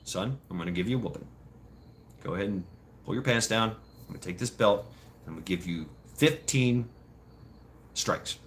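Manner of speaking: low, soft voice of measured menace; hushed, deep, emotionless sentencing; calm stern dad. Retold or imitated. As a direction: imitate dad quietly announcing a punishment. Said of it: "dad was".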